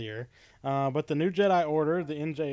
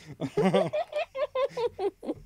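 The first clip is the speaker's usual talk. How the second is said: high laugh. Real, not acted. Laughs at own terrible webcam.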